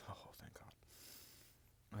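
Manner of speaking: breathless, relieved whisper after a laugh. Real, not acted. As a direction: sigh it out in a whisper. Relieved at finding his vape.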